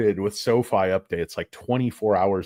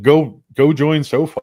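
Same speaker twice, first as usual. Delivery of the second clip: mock pitch; 6 semitones higher, 8 dB louder than usual. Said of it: "I'm like".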